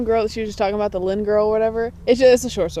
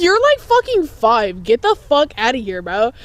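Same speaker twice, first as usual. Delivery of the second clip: incredulous rejection; 2 semitones higher, 4 dB louder, slower than usual. Retold or imitated. Older teen rejecting a little kid's crush, appalled. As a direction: stretch it out, appalled by the absurdity.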